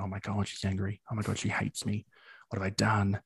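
fretting under the breath that she's angry; anxious muttered panic. Retold or imitated. Telling of the boss going quiet, a hushed worried mutter.

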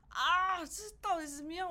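loud, high outburst of overwhelm; acted exasperation. Pet owner replaying their breaking point in grief, exasperated. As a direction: cry out as if at a loss.

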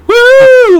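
long, very high, loud woo; mock cheer. Guest imagined running on the court, mock cheer. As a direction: whoop it out like a crowd cheer.

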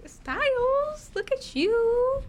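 high, slow cooing voice; baby talk to a cat. Acted. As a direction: coo at the kitty.

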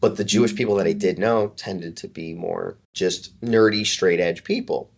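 ordinary delivery

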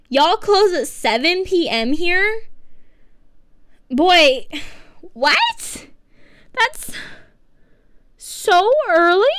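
can't believe they close so early; shocked outrage. Real, not acted. Outraged at Starbucks closing time.

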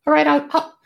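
high, fast little bat voice. Acted. GM voicing the bat.

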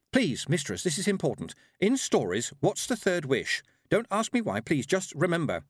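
high-pitched, insistent appeal; urgent pleading. A narrator's reading. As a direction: beg a stranger for the answer. Tiffany begs an old witch.

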